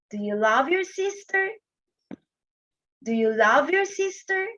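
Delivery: SISTER lifted high at the end; rising intonation. Shown as a demonstration. Teacher showing grade-one kids a yes-no question, rising intonation. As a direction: raise the pitch into SISTER and leave it up. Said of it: "It's a rising intonation".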